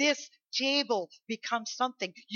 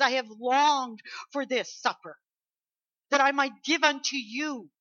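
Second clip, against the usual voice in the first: raised, eager preaching voice; excited Jesus quote; 5 semitones higher, 6 dB louder than usual. Acted. Preacher voicing Jesus at the supper, excited. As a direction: proclaim it with eager longing. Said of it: "that's why he was so excited".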